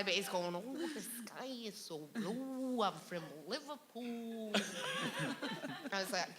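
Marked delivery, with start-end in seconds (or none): 0.6-4.5 put-on very slow, drawn-out bogus Liverpool accent; mock a possessed medium in an unplaceable accent; mystery accent. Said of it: "I don't know what accent that was".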